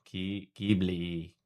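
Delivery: slow, soft Ghibli said GIB-lee; careful Ghibli. Shown as a demonstration. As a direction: sound out Ghibli slowly, hard G, ee at end. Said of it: "H is silent and I is E".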